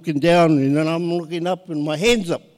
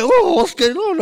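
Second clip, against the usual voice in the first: surprised; 12 semitones higher, 4 dB louder than usual. Retold or imitated. Swept into dancing church crowd.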